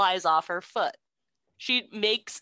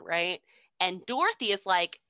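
ordinary talk

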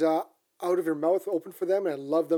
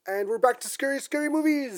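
excited; 8 semitones higher than usual. Real, not acted. Hyped return to scary movies.

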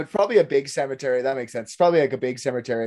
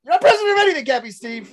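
mock angry yell; 11 semitones higher, 3 dB louder, faster than usual. Yelling at drunk.

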